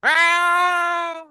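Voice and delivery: long, high, loud scream; sustained shriek of someone being shocked; drawn-out high wail. Acted. Villain hit by water and shock.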